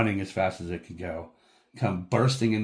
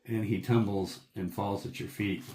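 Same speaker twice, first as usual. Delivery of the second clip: normal talking voice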